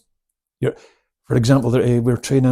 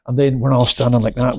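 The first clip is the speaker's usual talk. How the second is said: normal talking voice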